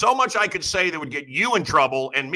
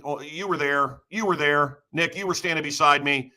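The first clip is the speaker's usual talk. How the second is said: normal talking voice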